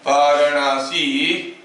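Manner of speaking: Varanasi said slowly in four drawn-out syllables; slow, deliberate Varanasi, rising at the end. Shown as a demonstration. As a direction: stretch Varanasi so each syllable counts. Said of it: "four syllables".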